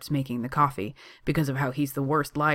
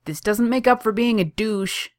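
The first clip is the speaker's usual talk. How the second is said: higher, louder called out; shouting after; 8 semitones higher, 6 dB louder than usual. A narrator's reading. Kaner shouting after the departing Johnny. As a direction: call it across the shop. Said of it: "Kaner shouts after him".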